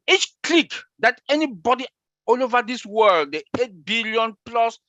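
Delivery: high-pitched, excited pitch to the crowd; fired up. Real, not acted. Answering a question about domains, fired up. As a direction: get carried away, pitch jumping up. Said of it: "when I'm fired up, I talk like this".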